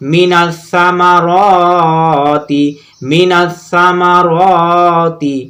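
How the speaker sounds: slow, with the র in সামারতি held long; drawn-out vowel. Shown as a demonstration. Teacher recites for students, lengthening the র of সামারতি. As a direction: stretch সামারতি on its "র". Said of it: "র টেনে পড়বো".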